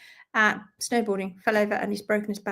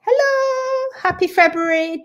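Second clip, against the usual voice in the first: sing-song hello; 10 semitones higher, 6 dB louder than usual. Real, not acted. Greeting viewer in sing-song.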